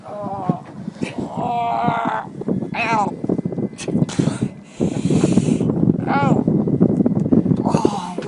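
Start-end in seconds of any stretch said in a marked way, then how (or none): 1.2-8.2 sharp cries of real pain. Real, not acted. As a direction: groan out as the hook hurts. Hook being pushed through skin.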